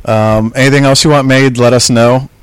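ordinary talk

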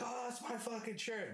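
high outraged shout; furious about the shirt; outraged yell. Retold or imitated. Yelling at roommate about his shirt, high outraged.

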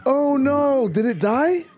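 high, drawn-out horrified reaction; dismayed wail. Real, not acted. Reacting to video of dying horse.

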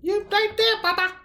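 very high mock squeak; squeaky high voice. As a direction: pitch way up like a cartoon.

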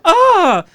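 high, loud startled "Ah!"; hammed-up frightened yelp; mock startled shriek. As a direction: squeal like a nearly hit shopper. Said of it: "making this big deal, like".

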